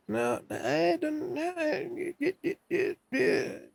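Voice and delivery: very high, squeaky gibberish. Pretend. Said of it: "just making noises at you people".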